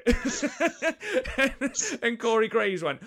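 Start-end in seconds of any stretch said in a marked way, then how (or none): 0.1-2.0 loud, high laugh bursting out. Real, not acted. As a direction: crack up at the story. Big laugh at Graves' reaction.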